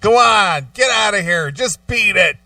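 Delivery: loud, high shooing yell; mock tough guy shout.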